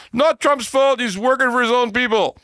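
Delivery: high-pitched mock protest; high, hurried whine of a mocked critic; mocking objectors. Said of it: "lots of people out there like to say".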